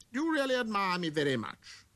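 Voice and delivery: fishing eagerly for praise; conceited man. Acted.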